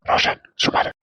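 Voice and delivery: put-on hushed aside to the boy; whisper. Hushing the child aside. (குழந்தையிடம் ரகசியமாக கிசுகிசுப்பு)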